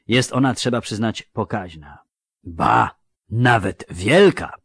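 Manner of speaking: mock-solemn lecture in a dwarf's voice; weighty delivery, swelling loud then dropping at the end; very serious. A narrator's reading. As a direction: explain with grave, deliberate pauses. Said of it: "powiedział wreszcie bardzo serio".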